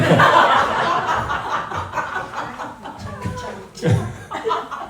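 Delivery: high breathless burst of laughter; long embarrassed laugh. Real, not acted. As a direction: laugh out, caught red-handed. Embarrassed at being recognized.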